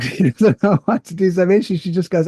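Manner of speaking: ordinary talk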